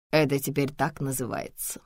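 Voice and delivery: plain reading voice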